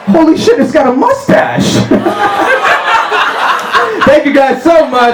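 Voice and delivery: the doctor yelping high at what he sees; shocked shout. Pretend.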